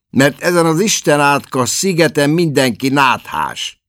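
a cross brother ranting; lightly voiced grumpy Larry. A narrator's reading. Larry grumbles about the island. (ingerült, panaszkodó Larry)

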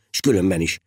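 ordinary delivery, nothing marked

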